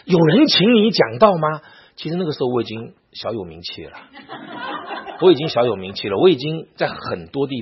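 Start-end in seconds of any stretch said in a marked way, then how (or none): 0.0-1.6 loud, high, scornful interrogation; imitating an angry teacher's rebuke; acted scolding. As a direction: berate the student with raised voice. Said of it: "他就骂我".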